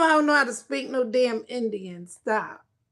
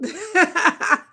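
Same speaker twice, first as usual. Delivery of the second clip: laughing; 5 semitones higher, 7 dB louder than usual. Real, not acted. Laughs at own joke.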